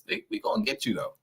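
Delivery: ordinary talk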